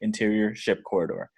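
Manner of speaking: normal talking voice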